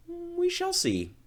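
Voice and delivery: high, slow knowing reply; ominous wife. Acted. Wife answers her hopeful husband, slow high sly warning.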